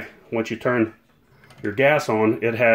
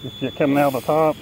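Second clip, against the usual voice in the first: through a respirator; 4 semitones higher, 5 dB quieter than usual. Real, not acted. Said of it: "through this respirator".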